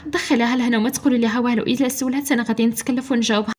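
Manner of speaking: plain reading voice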